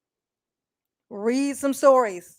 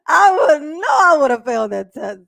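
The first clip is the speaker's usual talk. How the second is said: laughing; 9 semitones higher, 8 dB louder than usual. Real, not acted. Laughing at own joke.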